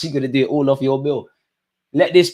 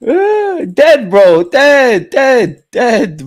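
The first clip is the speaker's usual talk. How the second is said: high, loud "dead" over and over; cracking up; 11 semitones higher, 7 dB louder, much slower than usual. Real, not acted. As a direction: lose it at a joke in chat. Cracking up at chat comment.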